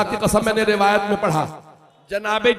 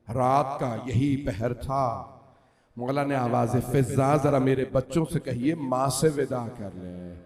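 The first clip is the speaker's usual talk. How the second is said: hushed mourning narration; 6 semitones lower, slower than usual. Acted.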